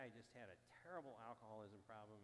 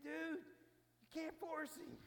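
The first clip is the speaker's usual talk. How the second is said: higher and louder, pushing back; urgent plea; 13 semitones higher, 6 dB louder, slower than usual. Retold or imitated. Narrator urging his buddy to stop forcing him, higher and louder.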